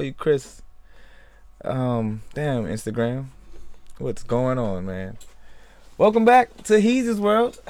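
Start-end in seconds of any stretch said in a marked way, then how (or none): 6.0-7.6 higher, louder host intro; show intro. Real, not acted. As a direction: announce it like a host.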